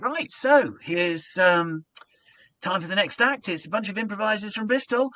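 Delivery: cheerful compere bringing on the act; compere announcing the next act. Retold or imitated. Compere introducing the improv troupe to the crowd, upbeat announcer. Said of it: "And then I went".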